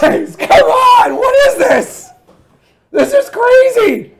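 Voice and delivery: high, drawn-out excited shriek; loud, laughing, incredulous squeal; overwhelmed excitement. Real, not acted. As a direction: cry out in disbelief at the windfall. Overwhelmed by another big donation.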